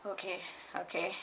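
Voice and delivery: patient accepting the anemia news, meekly soft; meek okay. Retold or imitated. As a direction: barely say it, deflated. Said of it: "And I was like".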